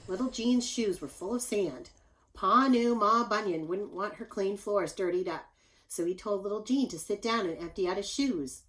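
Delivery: ordinary delivery, nothing marked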